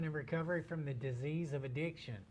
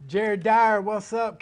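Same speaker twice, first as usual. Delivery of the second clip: higher, much louder greeting; excited shout-out; 6 semitones higher, 13 dB louder, slower than usual. Real, not acted. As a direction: call out to a viewer happily. Greeting a viewer by name.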